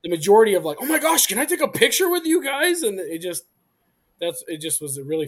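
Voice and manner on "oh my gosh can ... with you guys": higher, louder gushing imitation; excited fan. Convention visitor asking the bearded men for photos, excited fan.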